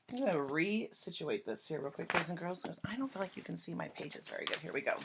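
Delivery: usual speaking voice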